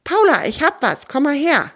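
höher, begeistert gerufen; begeistert rufend. Acted. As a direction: ruf sie aufgeregt herbei. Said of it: "ruft er begeistert". (Theo ruft Paula begeistert zu sich, aufgeregt)

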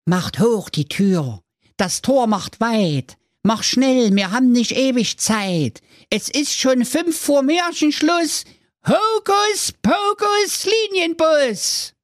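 very high slow incantation, not murmured; conjuring spell. Acted. Youngest son conjuring the bus door, high and slow. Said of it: "Er murmelte beschwörend".

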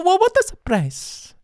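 very high squeaky fake delight; mock surprise. A dead singer appears, high mock surprise. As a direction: squeal it like a cartoon.

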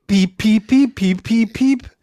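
imitated bird chirp. Shown as a demonstration. Repeating the bird call when asked again. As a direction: peep like a little songbird. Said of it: "Kannst du es nochmal machen?".

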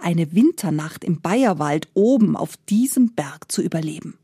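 usual speaking voice

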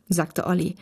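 ordinary delivery

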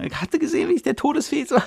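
high, bubbly schoolkid gush; high-pitched mock excitement from classmates; excited kids. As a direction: gush like thrilled students after class. Students recounting class excitedly.

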